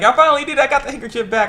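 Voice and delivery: high-pitched, relieved delight; acted-out cheerful announcement; happy. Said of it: "she's happy".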